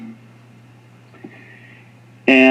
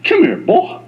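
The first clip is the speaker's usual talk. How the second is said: high-pitched, coaxing call; playful high summons; Jesus summoning him; 10 semitones higher than usual. Acted. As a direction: call him over as a parent would a boy. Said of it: "And here's Jesus going".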